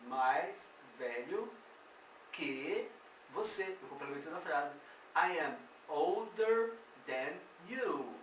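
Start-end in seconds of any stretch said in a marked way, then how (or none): 5.2-8.1 put-on slow English, older said most strongly; walk the class through it slowly; slow model sentence. Shown as a demonstration. Teacher repeating the example for students, slow. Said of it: "Faz de conta que esse er aqui é um mais".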